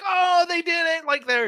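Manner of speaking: high, thrilled squeal of recognition; excited recognition. Retold or imitated. Telling Heather about the callback, excited high squeal. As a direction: pitch shooting way up in delight. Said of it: "when I was like".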